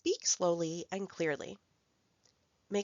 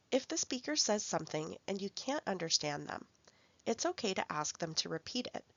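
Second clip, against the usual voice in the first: ordinary talk, nothing marked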